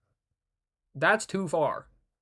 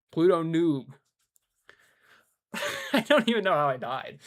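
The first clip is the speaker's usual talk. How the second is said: normal talking voice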